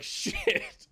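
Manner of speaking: drawn-out whispered curse; whispered dismay. Retold or imitated. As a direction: let it out as an aghast whisper. Retold dismay at being suspected.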